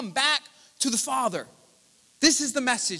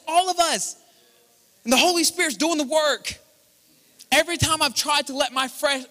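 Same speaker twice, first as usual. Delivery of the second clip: louder, higher preaching, proclaiming hard; full-force preaching; 4 semitones higher, 4 dB louder than usual. Acted. Preacher to his congregation, proclaiming at full force. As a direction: drive the promise home to the room.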